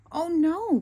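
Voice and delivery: high, loud dismayed cry; cried oh no. A narrator's reading. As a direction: gasp it out in alarm. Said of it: "cried Patrick".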